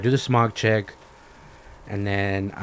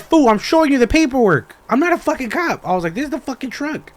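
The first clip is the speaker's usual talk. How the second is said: high-pitched indignant outburst; exasperated protest; 12 semitones higher, 5 dB louder than usual. Retold or imitated. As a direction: argue back, voice shooting up in disbelief. Retells arguing he is no cop.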